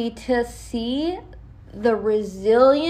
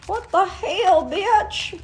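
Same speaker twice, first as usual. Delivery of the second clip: higher, put-on scolding friend; mock scolding voice; 9 semitones higher, slower than usual. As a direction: scold yourself in a higher comic voice.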